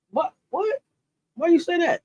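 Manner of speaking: jolted by a viewer's comment, asks why; shocked disbelief. Real, not acted. Streamer reacting to a viewer's claim about Gino, shocked and high.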